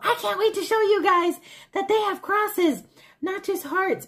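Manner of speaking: excited squeal. Retold or imitated. Shopper bursting to show viewers her find, squealing excitedly. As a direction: burst out high and thrilled.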